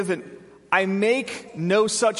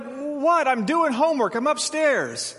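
put-on annoyed kid; 6 semitones higher than usual. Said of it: "And they're like".

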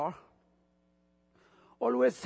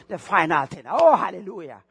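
ordinary talk

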